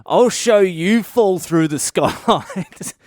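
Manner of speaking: mock the mate while laughing; laughing taunt. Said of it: "Trent starts laughing".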